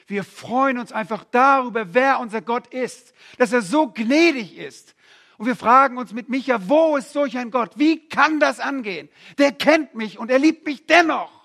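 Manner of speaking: impassioned pulpit voice; fervent preaching. Acted. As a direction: lift the room with joy. Preaches with rising force.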